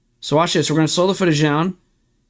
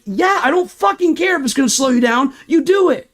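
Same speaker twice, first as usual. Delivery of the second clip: angry retort; 7 semitones higher than usual. Retold or imitated. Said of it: "Sorry I'm getting this angry".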